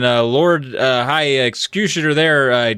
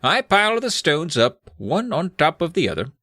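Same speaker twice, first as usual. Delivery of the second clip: high, put-on wizard voice; theatrical wizard, pitched up; explaining wizard; 4 semitones higher than usual. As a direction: play the old-world wizard explaining his magic.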